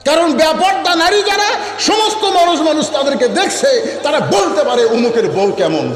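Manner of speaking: high, forceful sermon warning; full-force preaching. Real, not acted. Preacher warning the congregation, at full force. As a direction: pitch it high and hammer it out.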